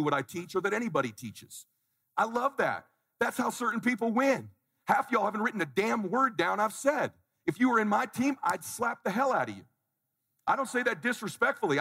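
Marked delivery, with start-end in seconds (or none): none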